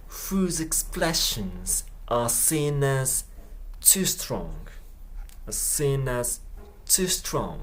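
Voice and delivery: very slow, stretched out; slow repeat. Shown as a demonstration. Teacher drilling the phrase, very slow. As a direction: crawl through each word. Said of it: "teacher repeats slowly".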